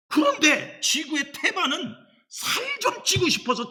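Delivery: high voice for the hungry world; mock pleading. Preacher voicing the starving world, mock pleading. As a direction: lift the voice high, mock yearning.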